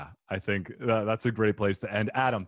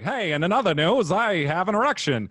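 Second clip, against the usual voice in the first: higher, louder mock Australian news voice; Australian accent; 9 semitones higher, 6 dB louder than usual. Hammed-up Australian accent to an audience. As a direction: put on a hammed-up Aussie announcer. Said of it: "if you're just like".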